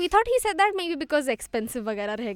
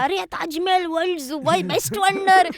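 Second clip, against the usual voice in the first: excited tout; 5 semitones higher, 5 dB louder than usual. Retold or imitated. Taxi driver praising Taj Mahal.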